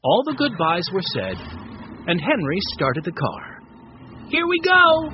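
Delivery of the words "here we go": high, loud happy cry; a boy's gleeful shout; excited cry. A narrator's reading. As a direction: call it out with joy. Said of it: "Benny cried".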